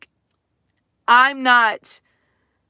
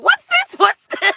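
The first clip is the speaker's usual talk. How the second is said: put-on curious kid; 5 dB louder than usual. Kids asking in store, mimicked.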